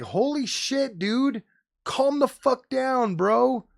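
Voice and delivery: high, slow incredulous calm the fuck down; exasperated retort. Retold or imitated. Streamer telling a reviewer to calm down, high and slow. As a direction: stretch it out, high and fed up. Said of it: "I'm like".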